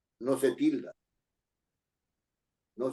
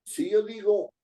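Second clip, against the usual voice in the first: usual speaking voice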